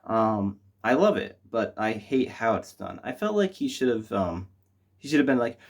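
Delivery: ordinary delivery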